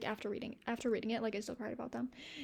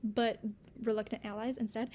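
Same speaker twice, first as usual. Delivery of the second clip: normal talking voice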